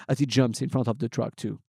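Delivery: ordinary delivery, nothing marked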